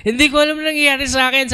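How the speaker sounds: high, fast teary imitation of the brother; brother in tears. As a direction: blurt it out through tears, bewildered. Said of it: "tumutuloy yung luha niya". (umiiyak na kuya, mabilis at mataas)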